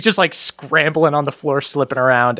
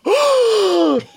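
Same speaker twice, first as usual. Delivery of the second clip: sharp high gasp; big high intake of breath; crowd gasping. Acted. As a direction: gasp in shock like onlookers upstairs. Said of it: "You hear echoes from upstairs of people gasping".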